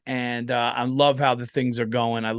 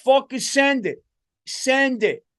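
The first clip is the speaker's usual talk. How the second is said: higher, louder guy firing off an order; reckless gung-ho guy; 9 semitones higher, 4 dB louder than usual. Acted. Imagined reckless leader at the end of times, gung-ho and pitched up. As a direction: play a guy throwing all caution away. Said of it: "just having a guy like".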